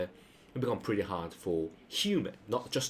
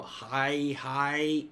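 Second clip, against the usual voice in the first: two long, raised "hi"s; frustrated repetition; 5 semitones higher than usual. Shown as a demonstration. Showing disrespect in Japanese, frustrated drawn-out double hi. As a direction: drag out each hi as if fed up. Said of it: "you're frustrated".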